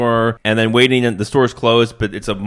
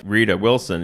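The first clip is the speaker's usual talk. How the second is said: usual speaking voice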